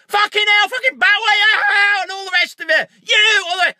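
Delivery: high, loud, raging shout with cries; acted fury. Shown as a demonstration. Actor showing how he'd play a scripted angry scene, staged furious shouting. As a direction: throw a full staged tantrum, yelling high. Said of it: "the script says you gotta get really angry".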